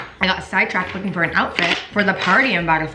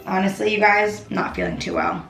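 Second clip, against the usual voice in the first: hungover. Retold or imitated. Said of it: "the vibe today is me just being like".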